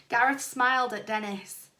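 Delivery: ordinary delivery, nothing marked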